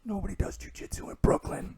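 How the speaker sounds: hushed whisper, dismissive. Retold or imitated. Two guys gossiping outside the shower, whispering. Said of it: "whispering to each other".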